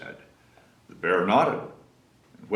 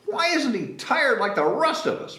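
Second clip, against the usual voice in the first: moaning bear; 13 semitones higher than usual. Acted. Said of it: "moaned the bear".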